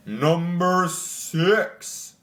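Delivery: slow, drawn-out announcer read of the number; mock announcer. Shown as a demonstration. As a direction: stretch it out like a game show host. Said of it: "I don't know why I'm talking like that".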